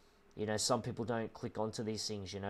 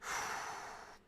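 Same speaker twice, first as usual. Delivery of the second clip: long breathy sigh of grief; deep sigh, holding back tears; heavy sigh; 7 dB quieter than usual. Real, not acted. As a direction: let out a slow, shaky breath. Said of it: "I'm just very emotional about it".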